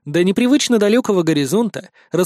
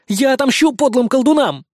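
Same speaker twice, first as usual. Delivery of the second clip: defiant threat; 7 semitones higher than usual. A narrator's reading. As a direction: vow revenge fiercely. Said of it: "погрозил кулаком Никитка".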